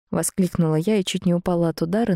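ordinary delivery, nothing marked

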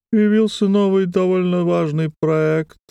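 high, quivering thin voice; trembling string voice. A narrator's reading. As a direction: voice like a trembling wire. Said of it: "своим голосом дрожащей струны".

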